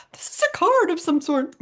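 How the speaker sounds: high, pleased surprise at the mailbox; mock delight. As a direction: act delighted to find a card. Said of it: "You're like".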